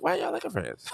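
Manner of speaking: put-on a high, soft, puzzled kid's voice. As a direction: play your younger self, baffled. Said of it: "I was like".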